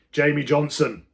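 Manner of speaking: loud angry call of a name; teacher shouting at a pupil. A narrator's reading. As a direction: bark the boy's name across the class. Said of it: "Mister Pratley shouted".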